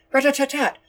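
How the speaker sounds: high, loud machine-gun rattle; imitated gunfire. A narrator's reading. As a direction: spit it out like automatic fire. Gunfire sound effect.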